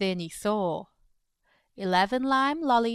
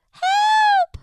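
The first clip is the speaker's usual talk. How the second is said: very high, loud cry of help; shriek for help; 8 dB louder than usual. Acted. Iguana with a wobbling belly, shrieking for help. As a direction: yelp it in sudden panic.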